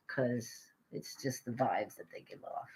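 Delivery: soft breathy aside; whispered aside. Real, not acted. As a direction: whisper it like a secret.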